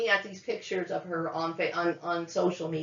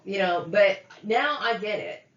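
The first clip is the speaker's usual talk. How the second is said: normal talking voice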